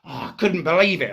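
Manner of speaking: normal talking voice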